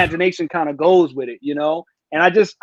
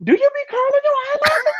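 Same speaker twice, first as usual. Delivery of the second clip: much higher, teasing woman's voice; imitating a curious woman. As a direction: play the nosy woman leaning in, pitched high. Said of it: "she'll be like".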